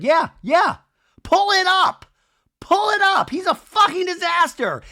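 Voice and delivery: very high, loud imitated yelling; hammed-up screamed taunt; mock-furious shriek. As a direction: shriek a dare at an ex. Retold screaming fight, Michelle daring him.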